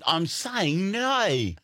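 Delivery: high, slow, exaggerated Australian vowels; Australian accent. Australian insisting on the word no, hammed-up Australian accent. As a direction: whine "no" as "noy" in a mock Australian accent. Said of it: "like, Australian people, when they say no".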